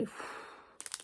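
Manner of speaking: soft, breath-only puff; overwhelmed puff. Real, not acted. Vlogger lost for words over flowers, soft puff. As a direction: blow out air, lost for words.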